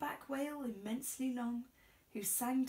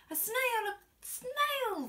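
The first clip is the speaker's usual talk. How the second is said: much higher, louder alarmed shriek; shrieking teacher; 13 semitones higher, 7 dB louder, slower than usual. A narrator's reading. Teacher spotting the snail, alarmed shriek. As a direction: scream at the sight of a snail. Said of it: "the teacher turns pale".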